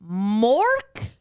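loud, rising, baffled mock indignation; incredulous. Pizza staff to dad, loud mock incredulity. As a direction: balk at the odd name, pitch climbing high. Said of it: "And they go".

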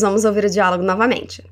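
normal talking voice

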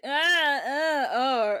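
loud, high mimicked wails; mock wailing. Villain taunting a wailing Rocket, mock wailing. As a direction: wail mockingly, like imitating a crybaby.

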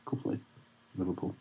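"Cool place" almost breathed away; near-silent repeat. Shown as a demonstration. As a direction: drop "Cool place" to a murmur. Repeated aside, dropped.

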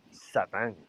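low, ominous devil voice. Retold or imitated. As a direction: announce the name like the devil. Satan answers Marco.

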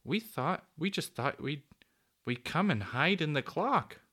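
high, hesitant explanation with restarts; falter, then get it out; mildly flustered little girl; finding her voice. A narrator's reading. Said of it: "finding her voice at last".

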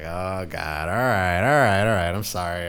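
loud sheepish apology; mock backing off. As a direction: hands up, retreating apology. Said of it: "I was like".

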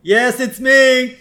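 high, loud, slowly stretched reply; mock megaphone call. Homer repeating the chief's words into megaphone, loud mock call.

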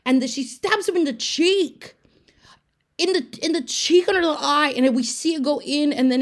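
high, loud, shrieking about the stab; a gleeful, high, loud horror-fan squeal; excited shriek. Real, not acted. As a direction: squeal it out in disbelief. Excited retelling of gore.

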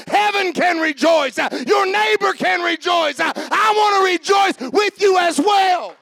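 loud, high, fervent preaching; preaching at full force. Acted. Preacher to congregation, loud and fervent.

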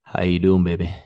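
close, husky pickup line; right in your ear. Pretend. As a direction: lean into the mic and purr. [name] whispering into listeners' ears.

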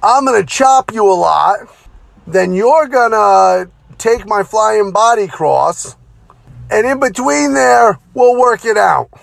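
wired veteran laying out the match, pitched high; Jimmy Snuka impression. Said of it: "He says".

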